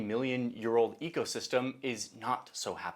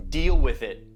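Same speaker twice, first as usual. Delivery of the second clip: louder, punched put-down; emphatic retort; 11 dB louder than usual. Acted. Ecosystem answering the sheep farmer, emphatic retort. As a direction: lay down the law.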